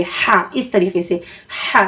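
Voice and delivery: demonstrating the big हा from the throat; throaty ha. Shown as a demonstration. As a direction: push हा out from the throat. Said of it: "हलक से अदा करके पढ़ेंगे".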